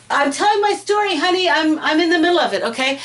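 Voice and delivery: high, fast, exasperated aside to her husband; annoyed aside. Real, not acted. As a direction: shoo off the interruption, real irritation. Talking to husband off camera.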